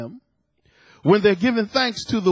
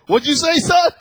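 raised, louder challenge to the son; the Holy Spirit rebuking a grumbling son, mock stern; 6 semitones higher, 5 dB louder than usual. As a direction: call him out, rising up high on son. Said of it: "And the Holy Spirit said".

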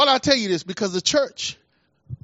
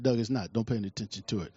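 much lower and softer, quick, settling a new convert's worry; calm reassurance; 11 semitones lower, 9 dB quieter, faster than usual. Retold or imitated. Pastor quietly reassuring his new convert friend Doug, soft and low. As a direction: brush the fear off quietly. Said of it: "I said".

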